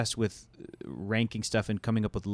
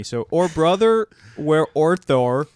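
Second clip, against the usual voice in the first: slow, louder, mouth stuffed with peanut butter; mouth full of peanut butter; 5 semitones higher, 7 dB louder, slower than usual. Pretend. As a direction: talk through a sticky mouthful. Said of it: "Just a mouthful of peanut butter".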